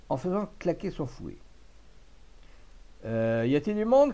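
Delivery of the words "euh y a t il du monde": a mild call into the empty shop. Acted. Old farmer entering the butcher's shop, mildly calling. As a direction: call out for someone, not too loud. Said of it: "cria-t-il".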